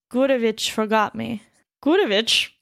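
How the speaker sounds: ordinary delivery, nothing marked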